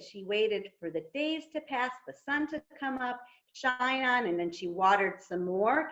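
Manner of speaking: plain reading voice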